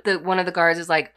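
usual speaking voice

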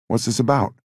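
impatient repeated question; pressing repeat. Acted. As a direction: push the question now that it's been dodged. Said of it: "he asked again".

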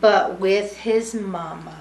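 lively sing-song storybook reading; reading to children. A narrator's reading. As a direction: read it warmly to a room of kids. Reading picture book to children.

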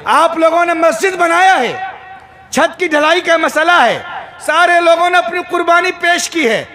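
high, sustained sermon call. Real, not acted. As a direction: proclaim it to the whole congregation on a high pitch. Preacher addresses the congregation. (ऊँचे सुर में जोशीला ख़िताब)

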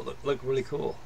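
ordinary talk, nothing marked

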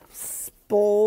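loud, drawn-out SPLASH; exclaimed splash. A narrator's reading. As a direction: burst out with a big splash. Story sound word acted out.

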